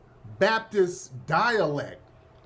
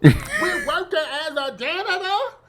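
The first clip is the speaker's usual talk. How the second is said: mock AOC accent; 11 semitones higher, 3 dB louder than usual.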